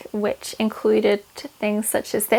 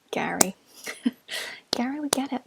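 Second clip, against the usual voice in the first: whisper; 4 dB quieter than usual. Retold or imitated. Whispered crush name.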